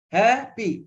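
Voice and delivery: "Happy" split as ha, then pee; syllable by syllable. Shown as a demonstration. Teacher giving another two-syllable example, syllable by syllable. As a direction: break "Happy" into two high, separate beats. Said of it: "two syllables also".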